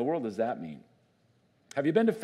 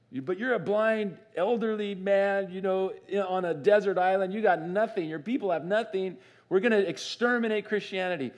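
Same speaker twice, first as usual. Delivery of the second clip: higher-pitched taunt of a scornful opponent; lightly acted jeering, pitched up; mock taunting; 6 semitones higher than usual. Persecutors belittle the blind exile on the island, mock taunting.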